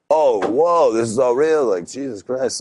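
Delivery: stunned realization. Retold or imitated. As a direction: let the shock hit, then trail off. Realizes the call was real.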